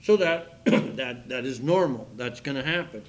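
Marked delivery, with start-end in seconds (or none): none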